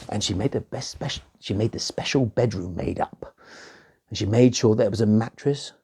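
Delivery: usual speaking voice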